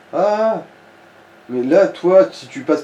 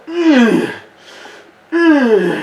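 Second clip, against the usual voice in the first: mock straining grunts; 10 semitones higher, 3 dB louder than usual. Mock straining lifting weights.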